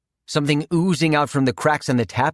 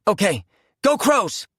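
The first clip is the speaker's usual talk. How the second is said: excited; 8 semitones higher than usual. A narrator's reading. Tossing the Molotov.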